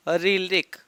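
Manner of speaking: ordinary talk, nothing marked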